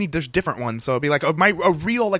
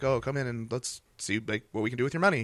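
soft phone banker voice; 3 semitones lower, 7 dB quieter than usual. Retold or imitated. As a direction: coax a client softly on the phone. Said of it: "I'd make appointments with people".

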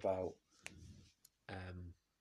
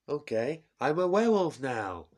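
put-on louder, higher proud Trump accent; Trump impression; 6 semitones higher, 12 dB louder than usual. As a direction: Trump shows off a mask. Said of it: "I can't get out of his accent now".

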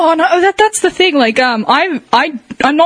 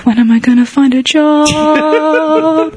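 mock melancholic; 3 semitones higher, much slower than usual. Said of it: "like, melancholic".

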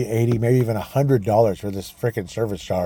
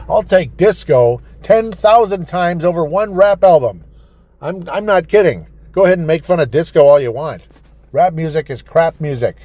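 heated rant; 7 semitones higher, 7 dB louder than usual. Real, not acted. Host ranting against rap music to listeners, loud and heated.

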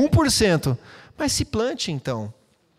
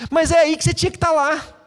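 a much higher, louder pushy friend voice; urging friend; 10 semitones higher, 4 dB louder than usual. Retold or imitated. Pastor voicing a friend who insists you come to church, higher and louder.

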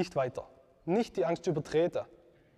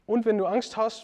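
ordinary talk, nothing marked

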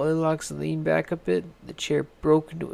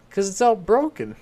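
higher, louder little bear; a small cub piping up; 5 semitones higher, 7 dB louder than usual. A narrator's reading. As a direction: cub crying out about his chair. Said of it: "said Little Bear".

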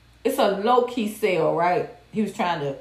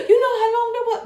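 high, louder laughing mockery; mocking his laugh; 13 semitones higher, 4 dB louder, faster than usual. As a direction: laugh the words out like he did. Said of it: "he started laughing like".

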